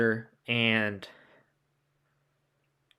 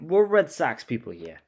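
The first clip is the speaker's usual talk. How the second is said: put-on New York accent; 3 semitones higher, 4 dB louder than usual. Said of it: "I said that in a New York accent".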